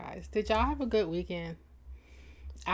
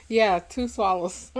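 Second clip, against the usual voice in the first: laughing through the words; 5 semitones higher, 5 dB louder than usual. Real, not acted. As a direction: chuckle it out. Laughing at own drinking.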